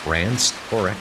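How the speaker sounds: usual speaking voice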